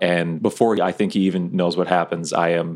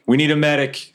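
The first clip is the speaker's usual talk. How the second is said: high-pitched call for help; 8 semitones higher than usual. Acted. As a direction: call down the hall for aid. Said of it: "I'll call".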